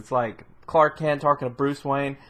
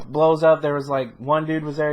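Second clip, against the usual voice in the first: ordinary talk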